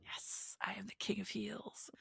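smug hushed whisper. Pretend. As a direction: breathe it like a gloating secret. Voicing Dominic, unvoiced whisper.

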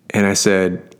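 ordinary talk, nothing marked

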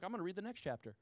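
sheepish aside; mock mumbled dodge. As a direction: mutter it to avoid the verse. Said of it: "and you're like".

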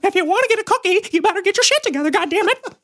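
Mickey Mouse scolding. Pretend. Mickey Mouse scolding young Ian, high squeaky and stern. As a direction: scold the kid in a squeaky cartoon voice. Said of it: "Mickey Mouse".

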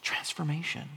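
breathy whispered single word. Real, not acted. Preacher naming what everyone longs for, whispered. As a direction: let it land in a hush.

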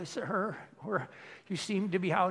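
put-on high, polite old-lady complaint; little old lady. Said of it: "the little old ladies would come up and they go".

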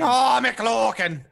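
high, stretched-out leprechaun cry; slow, hammed-up character call; McLokin impression. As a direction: draw the name out in a silly put-on voice. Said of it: "were you guys impersonating me?".

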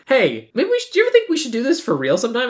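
high, quick hammed-up ask; mock Randy asking. Retelling Randy asking his friend out, high and fast. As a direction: play the nervous guy asking her out.

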